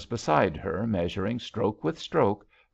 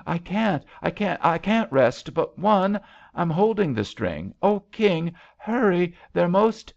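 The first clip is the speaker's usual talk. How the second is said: high, stammering cries, getting louder; panicked urging; 10 semitones higher, 5 dB louder than usual. A narrator's reading. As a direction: plead for haste with rising alarm. Drowning woman urges rescuer to hurry.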